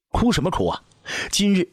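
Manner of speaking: high-pitched, imperious angry scolding; menacing rebuke. A narrator's reading. Jealous empress rebukes the weeping woman, high menacing scolding. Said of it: "杀气腾腾的呵斥".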